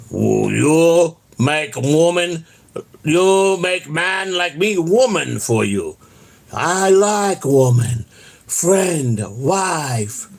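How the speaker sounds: very slow, broken monster speech; Frankenstein monster. Retold or imitated. The monster asking for a bride, slow broken grunts. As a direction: drag each word like the monster. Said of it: "that's Frankenstein".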